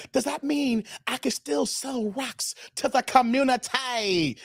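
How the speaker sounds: slow, stretched-out mock plea; comedy sketch quote. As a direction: drag the question out, savouring each word. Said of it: "remember the episode of, of Dave Chappelle".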